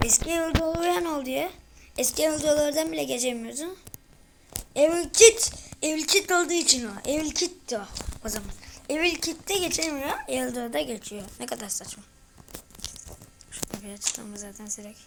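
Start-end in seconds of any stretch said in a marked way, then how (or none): none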